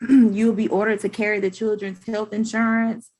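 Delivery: normal talking voice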